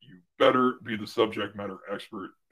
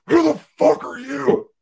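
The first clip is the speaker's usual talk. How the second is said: much higher, loud, strained yell; furious surgeon; 8 dB louder than usual. Retold or imitated. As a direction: bark it at the new kid like an outraged surgeon. Surgeon turns on the associate.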